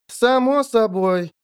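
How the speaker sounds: slow and drawn out. A narrator's reading. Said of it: "подтвердила Шурка Лаль".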